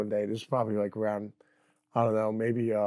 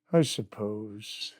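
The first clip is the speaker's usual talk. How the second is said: very slow, softer resigned mumble; 5 semitones lower, 6 dB quieter, much slower than usual. Retold or imitated. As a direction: let it trail off in a half-hearted shrug. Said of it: "He goes".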